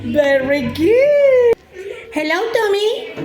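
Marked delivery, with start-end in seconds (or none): 0.0-1.5 slow sing-song praise; reading to a toddler. Acted. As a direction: stretch it out warmly for a small child. Teacher praising small child.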